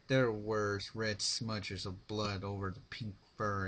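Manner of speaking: plain reading voice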